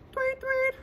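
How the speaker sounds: high chirpy tweet, tweet; bird tweets. Pretend. Imitating birdsong.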